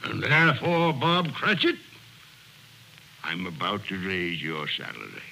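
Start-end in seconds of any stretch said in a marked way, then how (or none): 3.2-5.2 a solemn hush hiding good news; mock ominous hush. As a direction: drop low as if delivering a sentence. Scrooge springs his surprise.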